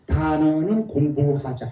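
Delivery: words stretched out for repeating; slow group drill. Shown as a demonstration. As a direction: lead the class slowly through it. Leads the audience in slow repetition. (따라 하도록 길게 늘여서)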